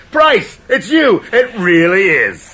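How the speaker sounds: overjoyed recognition, half laughing; delighted surprise. Acted. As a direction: greet a long-lost friend. Recognising an old acquaintance.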